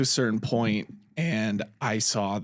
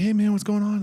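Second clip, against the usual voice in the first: higher-pitched call to a stranger; 8 semitones higher, 4 dB louder, faster than usual. Retold or imitated. Walking up to a stranded driver, calling out. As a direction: hail someone, voice lifted.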